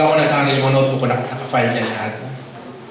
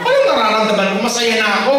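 cheerful laugh; 9 semitones higher, 4 dB louder, faster than usual. Retold or imitated. Cheerfully rejecting their sympathy.

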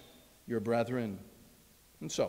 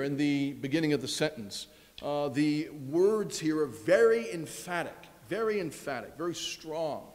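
normal talking voice

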